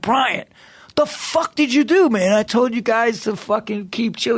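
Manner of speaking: high, mock angry yelling; a high, half-comic yell at Brian; mock exasperated. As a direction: yell at your buddy like he wrecked everything. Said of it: "I'm like".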